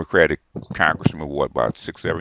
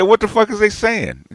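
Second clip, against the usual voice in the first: baffled; 13 semitones higher than usual. Retold or imitated. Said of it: "I said".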